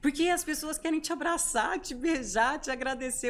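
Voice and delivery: put-on excited child's answer. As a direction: play the little boy proudly explaining. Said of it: "Por quê, filho?".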